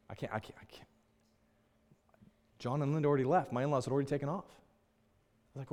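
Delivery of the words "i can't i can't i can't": tearful whisper. Retold or imitated. Crying on the kitchen floor.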